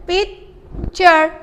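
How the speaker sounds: पिक्चर split into two slow syllables. Shown as a demonstration. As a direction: pull पिक्चर apart, syllable by syllable. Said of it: "इसको separate करें".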